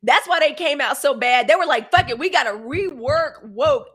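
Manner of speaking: loud, fired-up rant. Real, not acted. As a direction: preach it loud. Ranting about woke.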